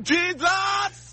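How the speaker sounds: high shouted call of Jesus; shouting the name Jesus high. Real, not acted. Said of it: "I dare you to scream".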